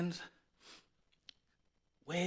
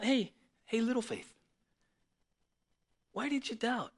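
softer, gentle calling by nickname; tender; 3 semitones higher, 6 dB quieter than usual. Retold or imitated. Jesus comforting Peter, soft tender voice. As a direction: coax him kindly. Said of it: "This is a tender moment".

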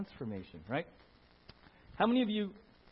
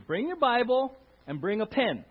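quoted exhortation; 7 semitones higher, 9 dB louder than usual. Retold or imitated. Quoting pastor's exhortation loudly.

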